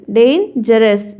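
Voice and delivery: "Dangerous" pulled slowly apart as "डेन - जरस"; teacher's syllable-by-syllable "dangerous", pausing after "डेन"; split into two syllables. Shown as a demonstration. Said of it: "दो चीज़ों को जोड़ के ऐसा लगे".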